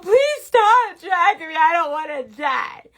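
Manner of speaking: hammed-up, high, loud wail; mock crying. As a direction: plead slowly as a crying brush. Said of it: "just imagine he's crying".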